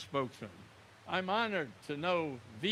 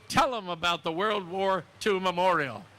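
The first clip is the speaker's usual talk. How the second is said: ordinary delivery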